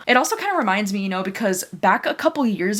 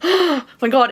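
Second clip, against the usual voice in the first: awed gasp; 5 semitones higher, 4 dB louder than usual. Real, not acted. Overwhelmed by the book's beauty.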